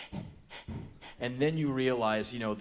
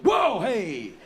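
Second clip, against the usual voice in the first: loud, high burst of alarm; startled yelp; 8 semitones higher, 10 dB louder than usual. Retold or imitated. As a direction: jump back with a shouted reaction. Acting out startled reaction.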